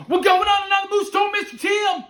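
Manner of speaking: fast, very high, loud yell; overexcited little boy. Retold or imitated. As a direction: blurt it out like a thrilled kid. Retold child bursts in over mother.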